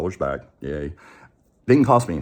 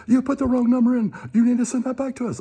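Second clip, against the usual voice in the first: much higher, mock insistent caller voice; pushy scammer; 4 dB louder than usual. Scammer orders the caller to pull up the form, high mock voice. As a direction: lay on the fake outrage, high-pitched. Scammer demanding money back.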